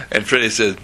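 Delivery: plain reading voice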